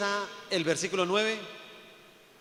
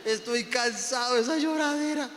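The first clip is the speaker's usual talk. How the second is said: God crying; 6 semitones higher than usual. Retold or imitated. Preacher imagining God weeping over indebted believers, hammed-up tearful lament. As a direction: play God weary and weeping over his children. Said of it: "me imagino a Dios llorando".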